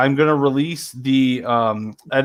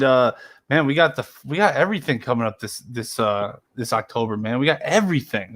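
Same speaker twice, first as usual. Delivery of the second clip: ordinary talk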